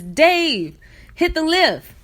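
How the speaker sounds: calling out to Dave. Retold or imitated.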